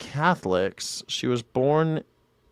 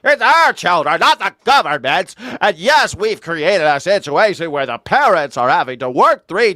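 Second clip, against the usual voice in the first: higher, louder mocking lecture; loud indignant parody of a prosecutor; mock politician rant; 6 semitones higher, 6 dB louder than usual. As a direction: parody her preachy speech. Mocking her parents speech.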